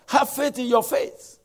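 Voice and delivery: loud, high-pitched preacher's slogan; mimicked preacher. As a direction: proclaim it like an overzealous preacher. Mimics preachers he finds obnoxious.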